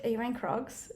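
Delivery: retold soft disapproval; judgmental question. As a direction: imitate a quietly judging stranger. Retold stranger judging her shoes.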